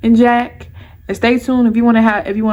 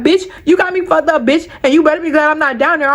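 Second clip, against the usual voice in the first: higher, mildly furious retold warning; retold mad texting; 4 semitones higher than usual. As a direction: tell her off without raising the volume. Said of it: "I'm mad as fuck".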